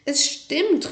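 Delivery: high, insistent cry; calling out. A narrator's reading. Marty to his doubting mother, calling out. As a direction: cry out to be believed. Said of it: "rief Marty".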